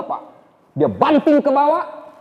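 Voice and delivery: normal talking voice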